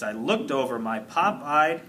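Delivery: usual speaking voice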